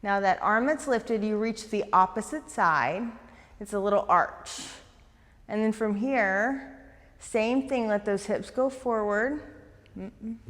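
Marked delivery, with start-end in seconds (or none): none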